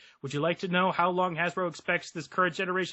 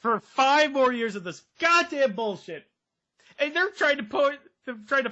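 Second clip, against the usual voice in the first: exasperated; 7 semitones higher, 4 dB louder than usual. Real, not acted. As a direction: spit it out, fed up. Exasperated rant.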